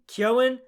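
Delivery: "Keown" said with no g sound; questioning, emphatic "Keown"; alternative pronunciation. Shown as a demonstration. Trying out pronunciations of a surname.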